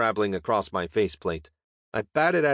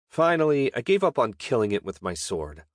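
usual speaking voice

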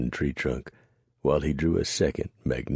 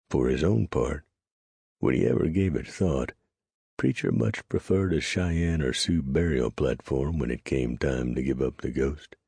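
usual speaking voice

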